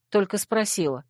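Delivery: plain reading voice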